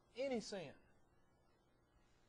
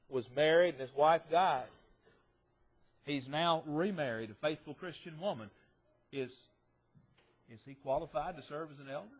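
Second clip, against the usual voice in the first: normal talking voice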